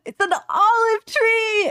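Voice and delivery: high, slow, loud gasping wonder; imitating excited girlfriend. Imitating girlfriend amazed by an olive tree, high excited squeal. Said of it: "never seen anyone that excited".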